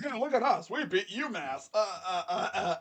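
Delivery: imitated bragging fans, pitch jumping up at the ends; mock flexing fans. Host mocking A and M fans after UMass win, mock flexing. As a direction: play a hyped fan showing off, mockingly. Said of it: "A and M fans were flexing that game hard".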